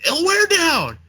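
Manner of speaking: high, whiny excuse, way up in the head. Acted. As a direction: plead for slack in a high whine. Voicing the stuffing eater.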